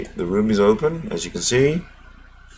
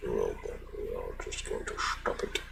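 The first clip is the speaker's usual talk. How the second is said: whisper; 13 dB quieter than usual. Real, not acted. Stopping the stream unless they join.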